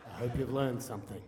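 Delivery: lightly voiced serious father, pitched low; low, measured imitation of a stern dad; a hint of a stern, serious father. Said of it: "my dad is this very serious, stern".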